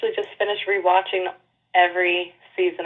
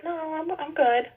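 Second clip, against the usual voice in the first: slow, high, hesitant no thanks; awkward polite refusal; 8 semitones higher, 4 dB quieter, slower than usual. Retold or imitated. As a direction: let her down gently, slowly. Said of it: "You're just like".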